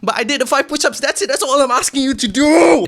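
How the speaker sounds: loud, high emphatic shout; loud insistence. Real, not acted. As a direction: hammer the point home. Insisting on the push-ups.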